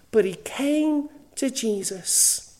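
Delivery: slowed down, leaning on came; slow emphatic repeat. Acted. Preacher repeating the swindler's turn, slow and emphatic. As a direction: draw it out, weighty and deliberate.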